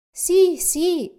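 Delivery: high, loud, quick eager agreement; two children eagerly promising the man; eager yes. Acted. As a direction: promise it excitedly.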